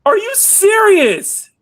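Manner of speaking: high, loud, drawn-out shriek; stunned disbelief. Real, not acted. Streamer reacting to a surprise tip, stunned shriek. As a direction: squeal it slowly in real disbelief.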